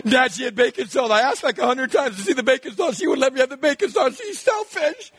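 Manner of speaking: tattle on your sister; whiny kid complaint. Retold or imitated. Nine-year-old complaining to dad, whiny.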